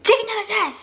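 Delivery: high-pitched, frantic order; freaking-out demand. Retold or imitated. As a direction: imitate a crying, panicked mom. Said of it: "freaking out, and then she started crying".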